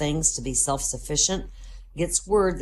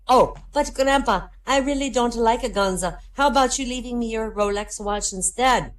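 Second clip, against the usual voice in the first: higher, pleading grandson's voice; whiny grandson; 7 semitones higher than usual. Acted. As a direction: grandson begging his grandpa for the watch instead. Grandson whines about guns.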